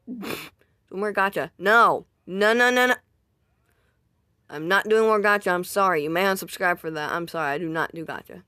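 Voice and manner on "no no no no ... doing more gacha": loud refusal. Real, not acted. Refusing requests for gacha.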